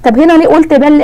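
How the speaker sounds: ordinary talk, nothing marked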